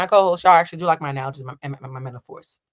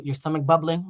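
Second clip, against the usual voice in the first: ordinary talk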